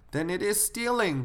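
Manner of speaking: put-on high-pitched child completing the phrase; little girl reciting. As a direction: answer the cue in a small child's high voice. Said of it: "the girl said".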